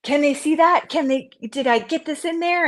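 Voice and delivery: anxious self-questioning. Retold or imitated. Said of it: "and like".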